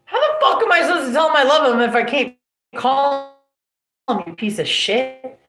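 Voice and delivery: high, loud furious retort; retold yell. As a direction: shout it at her in outrage. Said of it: "I said".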